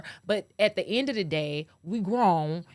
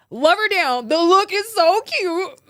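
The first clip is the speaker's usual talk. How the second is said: louder, higher gush of exasperated love; half laughing, half crying; 9 semitones higher, 8 dB louder than usual. Real, not acted. Gushing about Megan's look, louder and higher, near tears. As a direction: gush it like you're about to cry.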